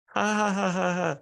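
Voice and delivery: high, forced mock guffaw; fake laugh. Stranger laughs at own bad joke, high forced laugh.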